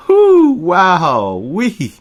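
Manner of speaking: very loud, high whoops; whooping. A narrator's reading. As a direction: whoop with helpless joy. Remy whooping.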